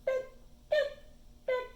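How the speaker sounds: three short chirped notes; brief, separate bird peeps; robin notes. A narrator's reading. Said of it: "She softly sings three notes".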